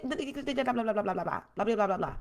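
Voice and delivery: rapid blah-blah gibberish, trailing down; mock nonsense talk with blahs; mocking babble. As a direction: babble over someone talking nonsense. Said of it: "That's how I do my son".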